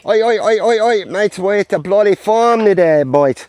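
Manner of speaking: high, loud Australian holler; hammed-up Aussie accent, called out to the crew; mock Australian. Teaching farm locals to be Australian, hollering in Aussie accent. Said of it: "teaching the locals how to be Australian".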